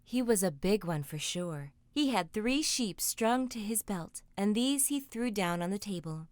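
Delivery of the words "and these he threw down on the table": plain reading voice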